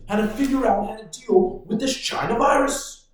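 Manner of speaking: ordinary talk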